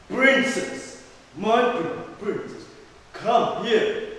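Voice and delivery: loud, high summons; king calling out. Acted. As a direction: call the princess over with authority. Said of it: "he called the princess".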